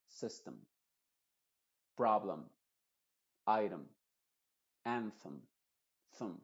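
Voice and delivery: "system", "problem", "item", "anthem" con la E final reducida; schwa final. Shown as a demonstration. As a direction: di el "em" final de "system" como "um" breve. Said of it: "con el sonido schwa". ("problem" e "item" con schwa)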